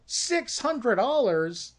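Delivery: disbelieving echo; stunned disbelief. Retold or imitated. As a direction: repeat the number like you can't believe it. Brothers stunned by small check.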